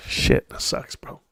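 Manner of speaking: breathy whisper under the breath; whispered aside. Real, not acted. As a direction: whisper it to yourself, deflated. Muttered aside about losing money.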